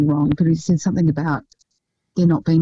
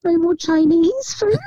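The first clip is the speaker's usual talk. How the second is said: put-on toddler voice; 12 semitones higher, slower than usual. Toddler voiced in retold video.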